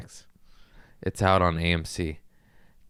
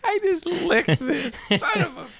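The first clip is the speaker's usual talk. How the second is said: laughing. Real, not acted. Laughing about licking the mic cover.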